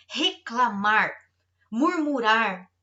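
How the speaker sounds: "Reclamar" and "murmurar" each drawn out slow and loud; slow heavy stress. Acted. Pastor naming the sins to the congregation, slow and heavy. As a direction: spell out each sin slowly.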